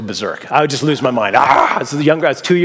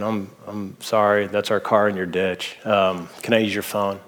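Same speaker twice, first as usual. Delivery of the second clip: put-on scared teen admitting the crash, voice dropped low; sheepish scared apology; 6 semitones lower, 5 dB quieter than usual. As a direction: own up nervously to a stranger. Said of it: "you're so scared".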